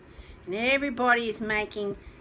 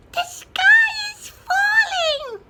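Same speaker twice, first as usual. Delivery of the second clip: very high, loud, slow shriek; tiny chick shrieking in panic; peeping chick in panic; 9 dB louder, slower than usual. Acted. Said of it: "he peeped".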